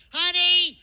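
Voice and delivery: loud high shouted call, slowing at the end; yelling up to a window. Retold or imitated. Wife yelling down from the window, mock loud shout. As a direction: call down six stories to her husband.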